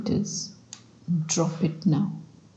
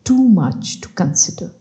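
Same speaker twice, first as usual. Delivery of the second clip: measured, louder story-reading voice; bedtime reading; 5 dB louder than usual. Acted. Meditation guide reads a book extract to sleepy listeners, measured reading. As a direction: read the extract aloud in unhurried phrases.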